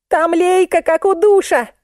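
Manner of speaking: high-pitched exclamation; excited discovery. A narrator's reading. Musenka spots a shower head overhead, excited. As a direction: cry out what you've just spotted.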